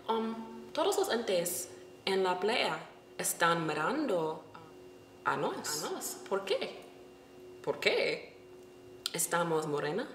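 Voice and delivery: a shy careful question; quiet hesitant Spanish. Retold or imitated. Asking the beach guy why people stare, quiet Spanish.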